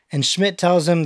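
ordinary delivery

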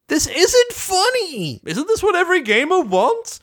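upset protest. Retold or imitated. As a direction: protest in dismay. Spike upset.

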